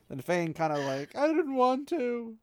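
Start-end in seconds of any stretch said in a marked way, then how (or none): 1.2-2.4 higher, slowed, lightly weepy plea; a mild mock sob of a man pleading innocence; tearful whimper. Fain pleading to the lord in a lightly tearful voice. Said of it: "big fat tears coming down his cheeks".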